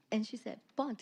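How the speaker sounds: ordinary talk, nothing marked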